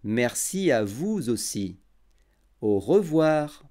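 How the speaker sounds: every syllable clear and unhurried; over enunciating. Shown as a demonstration. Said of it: "j'articulerai bien et je parlerai lentement".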